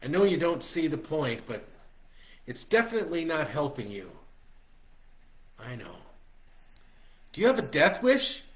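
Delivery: a father begging his son to care, rising to a shout; worried dad. A narrator's reading. Dad scolding his son about smoking, pleading and loud.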